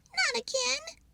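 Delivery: high squirrel voice, mild gasp; lightly gasped dismay. Acted. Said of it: "gasped Pip".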